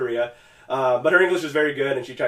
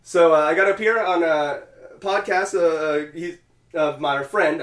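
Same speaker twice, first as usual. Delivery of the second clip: halting, tongue-tied delivery with filler sounds; stumbling; 3 semitones higher, 3 dB louder, slower than usual. Real, not acted. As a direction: lose the thread mid-sentence and fumble onward. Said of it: "I just started having a stroke there".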